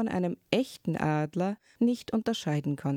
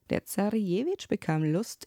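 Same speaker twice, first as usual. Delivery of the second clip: ordinary delivery, nothing marked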